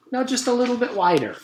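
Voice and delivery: high, sweet dentist voice; a sugary, sinister dentist. A narrator's reading. Dentist voice in story.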